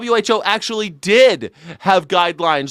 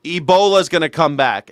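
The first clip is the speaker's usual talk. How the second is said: ordinary delivery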